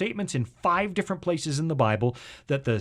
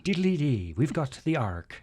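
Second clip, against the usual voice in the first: put-on Irish accent. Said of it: "you can do a good Irish accent".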